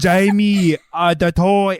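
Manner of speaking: put-on loud, thick Schwarzenegger-style Austrian accent; hammed-up Austrian accent impression; thick Arnold accent. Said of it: "thick ass accent on".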